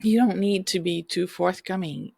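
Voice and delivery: ordinary talk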